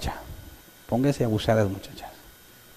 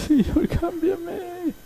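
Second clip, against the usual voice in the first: much higher hammed-up tearful wail; mock wailing prayer; 4 dB quieter, much slower than usual. A believer mocked while praying, wailing in falsetto. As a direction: cry out to God in an exaggerated falsetto sob. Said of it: "yo hasta lloré".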